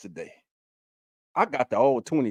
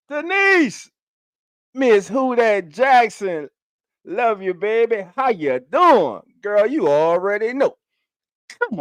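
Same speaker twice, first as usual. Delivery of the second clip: excited welcome; 8 semitones higher than usual. Real, not acted. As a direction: greet her like your favourite auntie. Greeting a female viewer.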